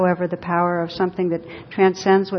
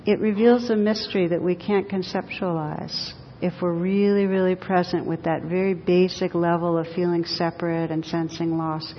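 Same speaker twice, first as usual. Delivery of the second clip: ordinary talk, nothing marked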